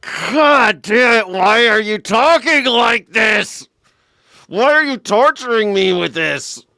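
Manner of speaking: slow, high, agonized shout; hammed-up howl of exasperation at New Yorkers; exasperated yelling. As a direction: drag out each word in a loud tortured yell. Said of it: "That's how I feel when I listen to New Yorkers".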